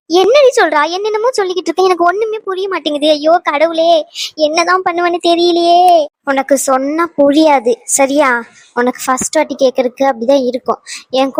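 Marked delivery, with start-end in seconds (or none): 0.1-5.8 high-pitched, helpless motherly lament; bewildered mother in a high voice; distressed mother. Acted. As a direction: plead in dismay, voice pitched up.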